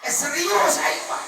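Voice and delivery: loud high laughing exclaim; laughing shout. Real, not acted. (ngagorowok bari seuri)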